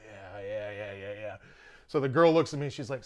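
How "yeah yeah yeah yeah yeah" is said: soft, low, dazed string of yeahs; hushed and low, mock lovestruck; smitten mumble. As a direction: imitate a love-struck friend agreeing dreamily. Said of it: "Wes is in love with one of them".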